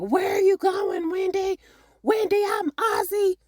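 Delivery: high shouted calling after her; mock screaming after her. [name] shouting after [name] as she walks away, high mock shout. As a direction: yell her name up high as she leaves. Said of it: "he's screaming at her".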